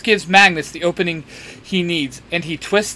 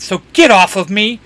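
loud, raised-pitch protest; annoyed yell; 6 semitones higher, 7 dB louder than usual. A narrator's reading. Magnus yells at Dean, who tackled him, annoyed. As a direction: yell it to shove someone off. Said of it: "Magnus yells".

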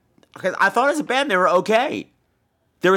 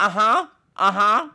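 sarcastic humouring; 5 semitones higher than usual. Retold or imitated. Humouring her while mimicking her.

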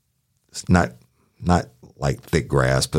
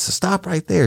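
higher, urgent order at gunpoint; retold command. Deputy ordering the armed woman, tense raised command. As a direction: bark it up high and tense.

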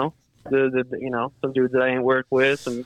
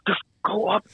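impatient order; 8 semitones higher than usual. Retold or imitated. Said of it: "he's like".

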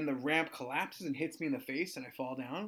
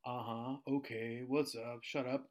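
lower, softer mimicry of song lyrics; seventh grader's taunt; 3 semitones lower, 6 dB quieter, slower than usual. Seventh grader teases substitute with his lip-synced song, low mock taunt. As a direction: lightly imitate a kid quietly quoting lyrics. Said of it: "she looks at me and goes".

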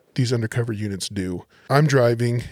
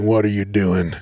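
low, gravelly imitation of a woman; mock smoker's growl; 4 semitones lower than usual. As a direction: put on a low two-packs-a-day rasp. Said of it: "she had that gravelly".

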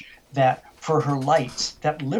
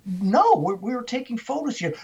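retold protest in a raised pitch; indignant denial; 8 semitones higher than usual. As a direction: push back, higher and defensive. Retold quarrel, woman denies accusation.

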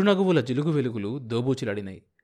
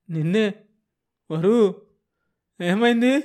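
each word higher and louder, panic rising; frightened calling; 8 semitones higher, 6 dB louder than usual. Acted. Husband calling his limp wife, higher and louder in alarm. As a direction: shake her awake with your voice.

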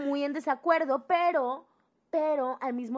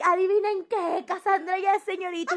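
higher, louder proud news; excited announcement; 8 semitones higher, 7 dB louder than usual. Retold or imitated. Father phoning relatives, mock excited announcement. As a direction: announce it like big family news.

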